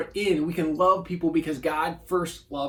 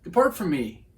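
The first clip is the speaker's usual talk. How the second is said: higher and louder, a curt brush-off; Jesus dismissing; 6 semitones higher, 6 dB louder than usual. Retold or imitated. Jesus turning away the ones who claimed his name, curt. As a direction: wave them away like a stranger. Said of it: "he's gonna go".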